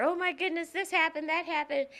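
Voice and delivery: high-pitched imitation of complaining; retold fretting. Daughter calling mother from college with troubles, mock fretting. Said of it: "and telling her".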